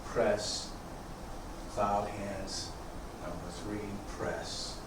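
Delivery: quiet unhurried cues, guiding the form; serene tai chi cues. Acted.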